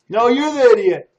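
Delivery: loud, high angry comeback; shouted retort. Acted. Prisoner yells the insult back at the other one. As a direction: throw the insult right back.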